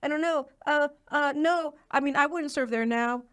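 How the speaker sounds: mock flustered senator. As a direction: stammer it out like a cornered old senator. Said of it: "and he's like".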